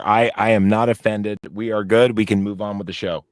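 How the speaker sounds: ordinary talk, nothing marked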